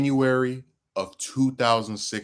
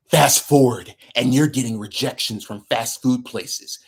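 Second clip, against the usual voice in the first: villain voice; 3 semitones higher, 5 dB louder than usual. Acted. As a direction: sneer it like a cartoon villain. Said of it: "my, my villain voice".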